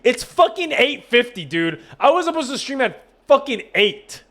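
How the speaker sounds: high-pitched, incredulous complaint; exasperated disbelief. Real, not acted. Late streamer telling viewers the time, exasperated.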